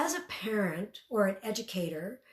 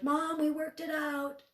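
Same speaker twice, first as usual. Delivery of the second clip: put-on child's proud report; 8 semitones higher, 3 dB louder, slower than usual. Kids report back to mom.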